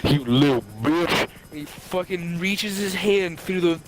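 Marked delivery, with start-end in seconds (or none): 0.0-1.3 low put-on growl of outrage; mock angry scream. Retelling the driver's outburst again, low mock-angry voice. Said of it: "and he screams at me".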